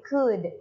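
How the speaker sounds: "could" said as "kud", L silent; a plain short "kud" for "could"; read as K-U-D. Shown as a demonstration. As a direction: say "could" as if spelled k-u-d. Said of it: "Seperti tulisan K U D".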